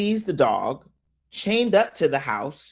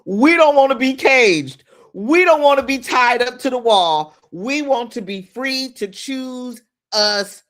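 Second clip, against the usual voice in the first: high, loud, preaching it; fired-up declaration; 9 semitones higher, 7 dB louder than usual. Real, not acted. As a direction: shout it out like a rallying cry. Preaching the point to entrepreneurs.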